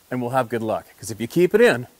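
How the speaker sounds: normal talking voice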